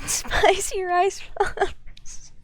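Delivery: high, slow, loud through laughter; laughing. Real, not acted. Streamer amused by the character's name, really laughing. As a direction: burst out laughing while saying it.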